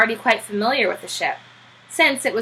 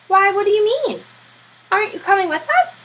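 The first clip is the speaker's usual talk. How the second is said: surprised Admiral; 11 semitones higher, 3 dB louder than usual. Acted.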